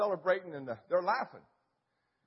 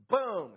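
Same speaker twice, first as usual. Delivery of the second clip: gunshot boom; 5 semitones higher, 5 dB louder than usual. Retold or imitated. Imitating his rifle shot.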